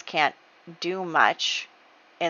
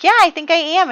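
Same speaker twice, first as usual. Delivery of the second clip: high, louder insisting; insistent reply; 11 semitones higher, 7 dB louder than usual. Acted. Francesca answering Jay's denial, higher and louder insisting. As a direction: push back on his denial.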